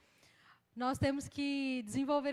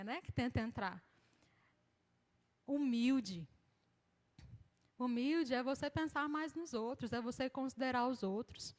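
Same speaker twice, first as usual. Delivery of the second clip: usual speaking voice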